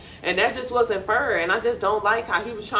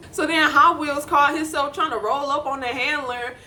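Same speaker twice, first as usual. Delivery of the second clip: ordinary delivery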